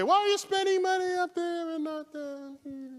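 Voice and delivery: high mock whine of a complaining public; mocking the people. Lecturer mocks critics of space spending in high whine. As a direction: parody the critics in a high nagging voice. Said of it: "So the people say".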